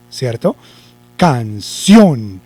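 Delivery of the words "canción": "Canción" split, with long emphatic CIÓN; stress on CIÓN. Shown as a demonstration. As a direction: stretch and punch the final syllable of Canción. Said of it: "para la sílaba sion y decimos". (Canción acentuada con fuerza en ción)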